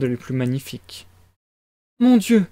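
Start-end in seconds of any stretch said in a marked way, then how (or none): 2.0-2.5 high-pitched dismayed exclamation; agitated mama's outburst; exclaiming. A narrator's reading. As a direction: cry out as a flustered mother. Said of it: "s'exclama Pulcheria Alexandrovna".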